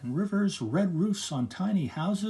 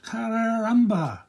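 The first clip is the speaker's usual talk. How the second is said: drawn-out calling of the name; long worried call; 7 semitones higher, 5 dB louder, much slower than usual. Acted. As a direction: call slowly as if searching. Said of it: "they called".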